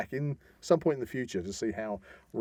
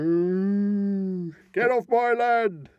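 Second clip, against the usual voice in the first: high, loud, slow mock farmer shout; cow and angry farmer; 7 semitones higher, 5 dB louder, much slower than usual. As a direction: moo then bellow at trespassers. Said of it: "was that a cow?".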